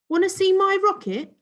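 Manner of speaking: high, loud eager show-off; eager boy. Acted. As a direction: show off your rocket keenly. Boy showing off to shy guests.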